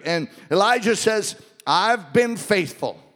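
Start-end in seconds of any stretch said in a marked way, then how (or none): 1.7-3.0 "I've been faithful" slow, high and loud, pleading; loud despairing complaint. Acted. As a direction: lean hard on "been faithful", drawn out. Elijah's first complaint.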